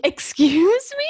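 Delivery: very high, loud, drawn-out question; appalled disbelief. Real, not acted. Host hearing they spat in the hat, appalled disbelief. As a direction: stretch it upward in scandalized shock.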